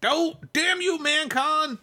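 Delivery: high-pitched mock cry of frustration; mock outrage. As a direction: curse the convention in hammed-up anguish, voice way up. Mock outrage at a convention clash.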